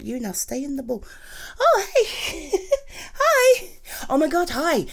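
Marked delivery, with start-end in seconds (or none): 1.6-4.9 delighted greeting. Acted. Partner walks in, delighted.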